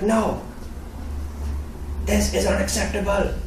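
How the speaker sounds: put-on high-pitched accented dad voice; imitating dad's stern accent; angry father. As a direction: refuse as an outraged dad. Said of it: "Screaming in my face".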